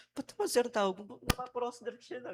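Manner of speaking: mock the HR woman's scolding question; a hint of a prim scolding HR voice; imitating the HR lady. HR scolding him, retold.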